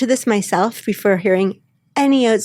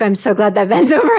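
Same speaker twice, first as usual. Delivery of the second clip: relieved giggle mid-sentence; laughing relief; 4 dB louder, faster than usual. Retold or imitated. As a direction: laugh through the words. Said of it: "I was like".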